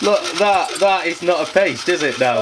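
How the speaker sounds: loud, high, helpless protest; incredulous laughing protest. Real, not acted. Cook objects that it is not a paste, laughing loudly. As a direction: protest through real hysterical laughter.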